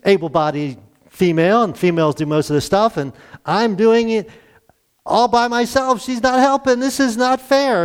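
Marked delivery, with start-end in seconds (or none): 3.5-7.9 put-upon complaint. Retold or imitated. Martha's complaint voiced.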